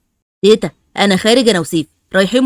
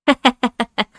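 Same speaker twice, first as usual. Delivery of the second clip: amused laugh; 4 semitones higher than usual. Acted. Amused at Ali's joke.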